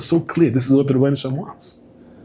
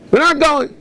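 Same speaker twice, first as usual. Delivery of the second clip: much higher, insistent refusal; shrill protest cry. Retold or imitated. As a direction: souls protesting against going down, shrill insistence. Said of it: "The Neshamas are saying".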